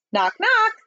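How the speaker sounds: high, bright-pitched knock-knock; eager high call; joking president. Acted. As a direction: pitch it up to open a joke. President starts a joke.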